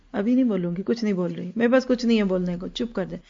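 low, soft refusal to talk; withdrawn mother. Acted. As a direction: drop your voice and shut the conversation down quietly. Mother refusing to answer child. (دھیمی آواز میں بات ٹالتی ماں)

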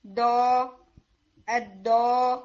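teacher demonstrating the wrong tip-of-tongue دو; wrong tip sound. Shown as a demonstration. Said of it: "میں یہ بالکل ٹپ سے sound دے رہی ہوں".

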